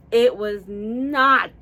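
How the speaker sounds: slow build into a loud, drawn-out "not"; emphatic, loud "not" after slow lead-in; stress on NOT. Real, not acted. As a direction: punch and stretch "not". Stressing the disappointment.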